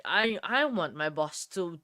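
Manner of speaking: ordinary delivery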